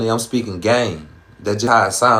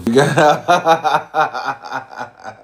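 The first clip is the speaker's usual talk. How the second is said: hearty laugh; 5 semitones higher than usual. Real, not acted. Streamer cracking up at a viewer's comment, hearty laugh. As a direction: crack up, laughter tumbling down to a finish.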